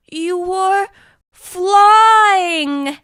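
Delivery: loud, high, stretched cry of wonder; amazed cry. Acted. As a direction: burst out in astonishment. Friend sees the bird flying.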